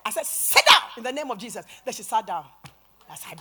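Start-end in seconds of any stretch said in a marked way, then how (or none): 0.2-1.7 loud exorcist's order; commanding. Retold or imitated. Preacher retelling her order to a possessed woman, commanding. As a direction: order the demon down with raised volume.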